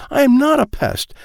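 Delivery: high, loud protest; indignant shout. Acted. Child protests being called pest.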